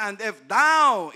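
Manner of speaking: verse stressed, slow and loud; slow scripture. Acted. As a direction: hammer out the words. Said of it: "ito ang question".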